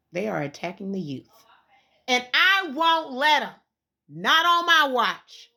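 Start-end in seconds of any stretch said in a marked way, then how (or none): 2.1-5.5 slow, loud vow; a defiant promise, slow and loud. Real, not acted. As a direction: declare it like a battle cry. Rallying close to her rant.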